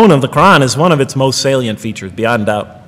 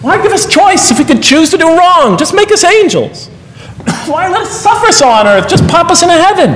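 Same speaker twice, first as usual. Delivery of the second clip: higher, incredulous voicing of the doubter's questions; mock-indignant doubter, pitched up; mock skeptic's complaint; 12 semitones higher than usual. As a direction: toss off the objections as a scoffing questioner. Voicing a skeptic's objections to God.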